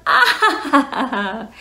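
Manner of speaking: laughing. Acted. Laughing kids song intro.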